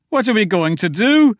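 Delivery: high, loud panic; a frightened man squeaking upward; panicky rising voice. A narrator's reading. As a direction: voice climbing in fear. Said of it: "voice was rising in octaves".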